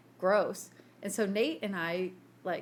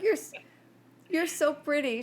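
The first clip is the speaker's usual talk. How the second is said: higher, sweet cooing; imitating Mom cooing at the dog; 6 semitones higher, 3 dB louder than usual. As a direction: coo to the dog like a baby.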